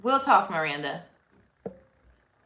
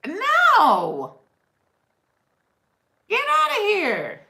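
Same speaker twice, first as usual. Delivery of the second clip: shocked disbelief; 13 semitones higher, 9 dB louder, slower than usual. Real, not acted. Shocked reaction to comment.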